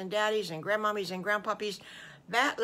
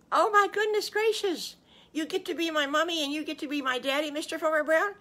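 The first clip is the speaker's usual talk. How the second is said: put-on high, fast, thrilled little-child voice; overjoyed Little Bad Wolf; 10 semitones higher, 6 dB louder, faster than usual. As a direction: squeal it out like an overjoyed little one.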